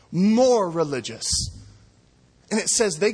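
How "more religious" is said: "More" stressed hard, louder; emphatic repeat. Shown as a demonstration. Preacher driving home his point, the word "More" loud and stressed.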